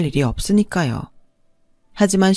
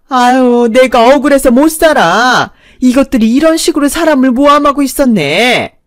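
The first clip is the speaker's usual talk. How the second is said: higher, louder aggrieved wail; a hammed-up old woman crying foul; whining protest; 5 semitones higher, 6 dB louder than usual. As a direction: protest the unfairness at full volume. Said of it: "억지를 부렸고".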